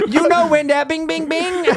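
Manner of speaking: high sung [name] impression; [name] doing [name]. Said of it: "[name] doing [name]".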